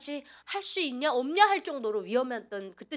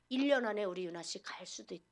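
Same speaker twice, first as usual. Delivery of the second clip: ordinary delivery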